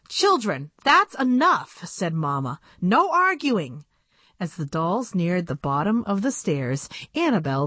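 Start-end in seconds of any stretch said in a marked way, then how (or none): 0.1-1.8 firm, raised-pitch motherly reprimand; mother's scolding. Acted. Mother stops children arguing. 2.8-3.8 slow, high-pitched firm warning; mother's scolding. Acted. Mother stops children arguing.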